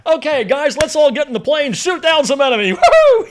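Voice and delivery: loud, high, whooping cheerleader of a major; rally the men like it's a big party; hammed-up jolly shout, breaking into a laugh; gleeful, laughing officer. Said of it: "He's having a good old time".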